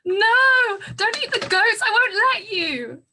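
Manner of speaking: loud, high, desperate no; child's protest. Acted. Girl yelling at the guests not to eat the goats, loud and high. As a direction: shout to stop them eating the goats.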